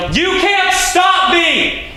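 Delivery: long, high full-out shout; drawn-out shout into open air; defiant yell. Acted. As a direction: yell it out slowly at the top of your range. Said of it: "I yell".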